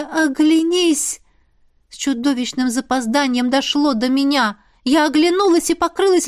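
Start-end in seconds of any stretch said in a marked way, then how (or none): 0.0-1.2 slow quoted realization. A narrator's reading. Вспоминает слова дракона.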